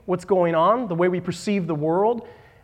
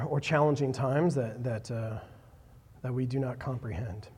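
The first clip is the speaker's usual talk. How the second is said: prayer; 5 semitones lower, 6 dB quieter than usual. Acted. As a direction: pray quietly and humbly before God. Opening prayer before the sermon.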